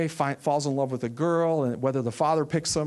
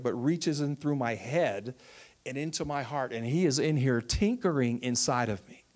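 ordinary delivery

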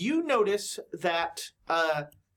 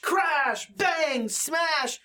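crashing commotion; 9 semitones higher, much slower than usual. Acted. Game master voicing the lobby hubbub for players, crashing commotion. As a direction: punch out each impact word, pitched high. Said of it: "what you do hear is".

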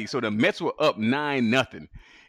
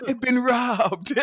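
high, drawn-out mock wail; laughing, pitched-up imitation of panic; mock alarm; 11 semitones higher, 3 dB louder, much slower than usual. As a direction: stretch out a hammed-up cry of panic. Retells thinking he was robbed.

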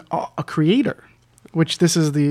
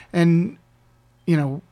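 ordinary talk, nothing marked

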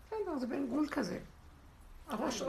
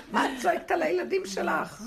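higher-pitched exasperated husband; lightly acted reproach, pitched up; mock scolding; 5 semitones higher, 4 dB louder than usual. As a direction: tell off a screaming wife, half in jest. Acted husband scolding screaming wife.

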